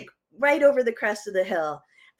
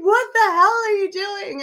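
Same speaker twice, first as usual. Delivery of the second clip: trucker's shout; 11 semitones higher, 6 dB louder than usual. Retold or imitated. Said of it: "he's like".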